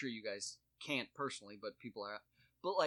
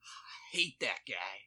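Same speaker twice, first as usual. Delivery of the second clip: mock grumble; 3 semitones lower, 3 dB louder, slower than usual. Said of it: "He's like".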